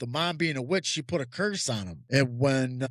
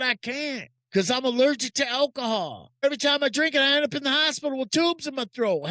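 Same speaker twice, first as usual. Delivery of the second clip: testimony preached with rising fervor, getting higher; preaching; 6 semitones higher, 4 dB louder than usual. Acted. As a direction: preach it to the congregation, building up.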